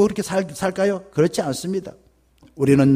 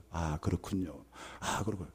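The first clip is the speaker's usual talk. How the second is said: very soft, low murmur of acknowledgement; hushed acknowledgement; 13 semitones lower, 18 dB quieter than usual. Acted. Pastor answering an ailing elder, hushed and low.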